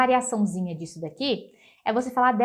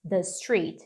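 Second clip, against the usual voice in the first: slow model phrase; 4 semitones lower, much slower than usual. Shown as a demonstration. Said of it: "do outro lado da rua".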